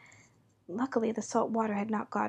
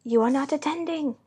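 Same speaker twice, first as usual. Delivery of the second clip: higher, louder sharp reprimand; severe; 4 semitones higher, 6 dB louder than usual. A narrator's reading. Mouse scolds Alice for not listening, severely. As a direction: scold an inattentive listener, raised voice. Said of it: "said the mouse to Alice severely".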